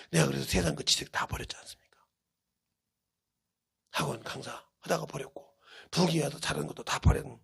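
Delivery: hushed confiding whisper. Real, not acted. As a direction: lean in and whisper it. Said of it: "whispered aside in sermon".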